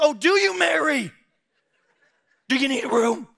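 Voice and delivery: high, loud sarcastic innkeeper; hammed-up mocking innkeeper. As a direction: sneer it up high like a snooty innkeeper.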